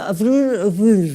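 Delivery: broken nonsense syllables, lightly acted; imitating a Broca patient's failed speech; garbled aphasic speech. Shown as a demonstration. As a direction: mumble a meaningless word like a patient who can't form speech. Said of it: "Y yo contesto".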